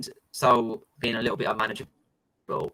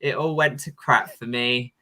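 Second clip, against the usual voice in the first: ordinary talk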